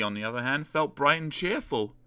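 plain reading voice

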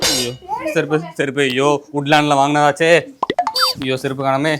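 mock wailing. Fan lamenting his lost sandals, mock wailing. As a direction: moan over the lost sandals like a tragedy.

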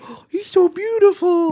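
high, loud cooing dog voice; love-struck dog. Acted. Dog seeing the lady bulldog, high mock-smitten coo. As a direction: swoon in a silly high voice. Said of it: "And the dog's like".

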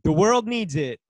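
insincere praise, each phrase set apart; sarcastic. Acted. Reading [name]'s tweet about Nike aloud, very sarcastic. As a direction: praise them as if you mean the opposite. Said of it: "a very sarcastic tone".

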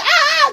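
high shriek, half laughing; mock scream. (grito agudo fingido entre risas)

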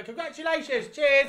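high, loud mock toast of a bored sober guest; mock dull guest. Sober guest mocked with a high loud toast.

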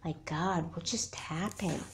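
soft, dazed murmur to oneself; just woken, quietly taking it in; under the breath, puzzled; hushed bewilderment. Acted. Waking alone from a strange dream.

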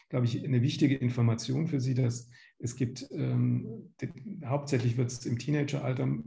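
normal talking voice

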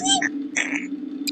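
very high squeaky voice, drawn out; silly squeal. Pretend.

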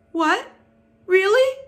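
high, loud, astonished hope; two squealed bursts of surprise; cried out. A narrator's reading. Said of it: "cried Wilbur".

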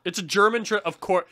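ordinary delivery

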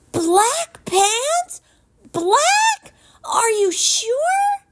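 high, loud, near-crying disbelief; distressed boy. Acted. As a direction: wail it in horror. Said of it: "Jameson gasped".

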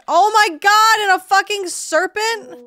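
high, loud panicked yell; panicked shriek. Real, not acted. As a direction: scream at the sudden serpent. Panicking at a monster.

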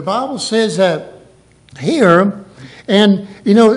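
sudden loud preacher's cry; preaching burst. Acted. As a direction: belt it out to the congregation.

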